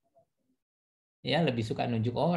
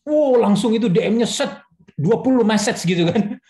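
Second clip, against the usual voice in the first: higher, louder astonished exclamation; surprised; 8 semitones higher, 8 dB louder than usual. Real, not acted. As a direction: burst out in amazement. Surprised at twenty messages. (kaget, suara tinggi dan keras)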